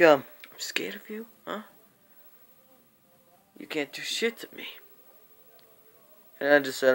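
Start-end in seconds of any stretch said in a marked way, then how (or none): 0.0-4.7 mocking words in a fast whisper; whispered taunt. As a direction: taunt him under your breath. Retold taunt from John.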